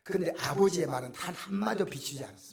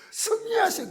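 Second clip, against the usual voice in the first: the blessing sung out much higher and louder, falling at the end; cheerful blessing; 7 dB louder than usual. Retold or imitated. Preacher re-enacting the doctor's parting blessing, high and bright. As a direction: beam it out like the sweetest farewell. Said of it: "그 말이 너무 듣기 좋은 거예요".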